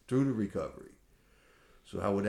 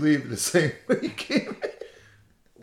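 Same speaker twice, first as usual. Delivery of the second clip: mock-solemn quoting; 6 semitones higher, 7 dB louder, slower than usual. Mock-solemn repeat of the punchline.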